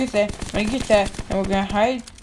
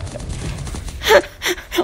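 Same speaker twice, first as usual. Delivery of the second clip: laughing cry; 10 semitones higher than usual. Real, not acted. Caught by enemy, nearly escapes.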